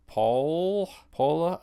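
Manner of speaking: "Paul, Paula" drawn out and rising high, as if asking; tentative, questioning stab at a French name; unsure pronunciation. Real, not acted. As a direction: try out the name, unsure, pitch climbing on each attempt. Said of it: "not sure how it's pronounced".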